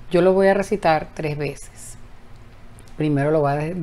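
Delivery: ordinary talk, nothing marked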